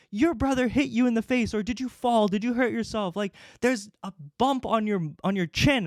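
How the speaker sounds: mom freaking out. Retold or imitated. Mom questioning son about the bump, alarmed. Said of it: "she starts freaking out".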